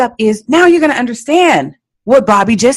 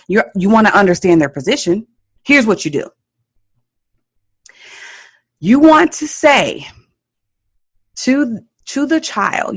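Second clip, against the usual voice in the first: normal talking voice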